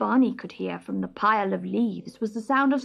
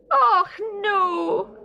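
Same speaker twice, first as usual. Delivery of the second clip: high, loud cry of dismay; a little stoat wailing at falling snow; 12 semitones higher, 5 dB louder than usual. Acted. Stoat crying out as the snow starts, high and loud. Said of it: "he cried".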